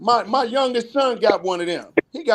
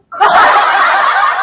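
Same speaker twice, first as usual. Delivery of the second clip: loud cackle; 13 semitones higher, 10 dB louder than usual. Real, not acted. Laughing at friend's slip.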